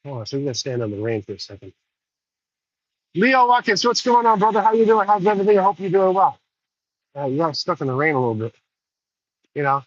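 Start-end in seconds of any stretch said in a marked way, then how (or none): 3.1-6.4 higher and louder, a big happy hello; loud friendly greeting. Real, not acted. Greeting a passing friend out in the rain, loud cheerful call.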